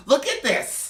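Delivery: put-on high, loud drunk whine; mock the tipsy friend. Imitating drunk Mary Jane.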